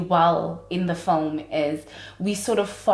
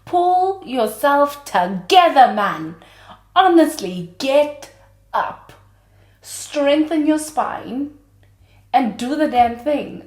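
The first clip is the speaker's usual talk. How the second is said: loud, high mock scolding; scolding my younger self; 6 semitones higher, 5 dB louder than usual. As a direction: chew her out, tough love. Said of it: "So I was just like".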